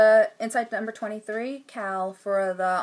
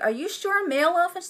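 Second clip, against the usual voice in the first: imitating wife; 9 semitones higher than usual. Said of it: "she's like".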